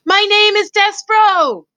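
high, slow, loud call; shouted. A narrator's reading. Mouse calling to the princess, shouting. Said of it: "He turned and shouted to the princess".

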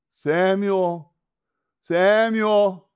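high, long-held call. Acted. God calls the boy a second time, long loud call. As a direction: stretch the name out loudly.

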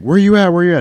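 high, loud anxious call. Retold or imitated. Pilot calling for the missing crewman, loud and high. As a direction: shout into the empty ship.

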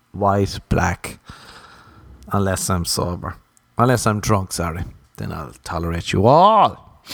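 ordinary talk

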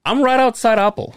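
high-pitched, indignant phone reply; acted-out disbelief, pitch jumping right up; hammed-up exasperation; exasperated retort.

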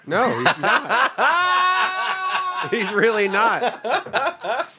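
laughing through the words; cracking up while denying it. Real, not acted. Laughing disbelief at Johnny's presence.

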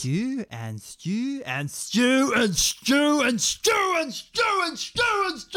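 slow, then rising to a high shouting rant; building manic shout. Acted. Chef breaks down under the doctor's questioning, shouting.

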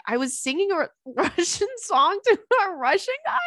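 high-pitched, laughing incredulous question; retold disbelief. Told she sang to a Russian guy, mock-incredulous. Acted disbelief at friend's report.

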